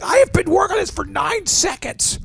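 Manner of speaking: very high, strained mock outrage; exasperated mock tantrum. User failing a captcha, high mock exasperation. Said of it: "the third time you're like".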